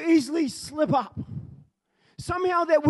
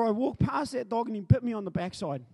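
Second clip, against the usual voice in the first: usual speaking voice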